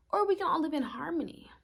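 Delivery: usual speaking voice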